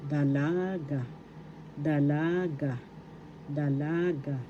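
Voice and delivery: careful teaching repeats of dalaga, da-LAA-ga; stress on second syllable. Shown as a demonstration. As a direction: stretch the LA in dalaga. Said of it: "the second syllable".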